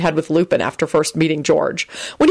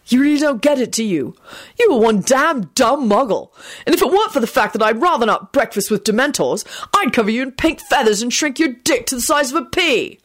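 higher-pitched, heated berating; scathing tirade; 7 semitones higher than usual. A narrator's reading. George threatening Quentin with hexes, scathing. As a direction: lay into him with contempt.

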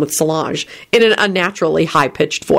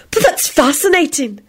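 high, admiring purr; provocative interest; 9 semitones higher than usual. A narrator's reading. Bea gazes up at Lupin, provocatively. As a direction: play up the fascination. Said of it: "in a positively provocative manner".

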